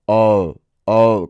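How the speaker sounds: "O" as a gliding ow vowel; sound between U and O. Shown as a demonstration. Teacher isolating the digraph, "O" gliding into U. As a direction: let "O" slide towards a U. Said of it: "como ese sonido entre U y O".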